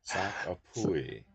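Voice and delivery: Sakapui drawn out, mock Chinese accent; slow, joking Chinese-accented Sakapui. Shown as a demonstration. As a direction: say Sakapui slowly as a Chinese term. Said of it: "the Chinese, uh, term for".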